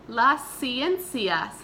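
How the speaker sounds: Las ciencias drawn out slowly and clearly; slow model word. Shown as a demonstration. Teacher modeling a word slowly for the class to repeat. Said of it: "Repite, por favor".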